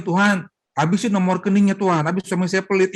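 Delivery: mock-pray for his savings to vanish; spiteful prayer. Wife praying against her stingy husband, mock spiteful. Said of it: "Ya jangan begitu".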